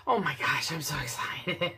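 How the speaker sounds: breathless excited whisper. Real, not acted. Maker whispers in awe at her finished resin flag.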